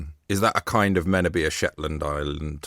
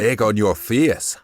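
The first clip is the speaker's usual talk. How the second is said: mock Shetland accent; 2 semitones higher, 4 dB louder, slower than usual. Echoes phrase in mock Shetland accent.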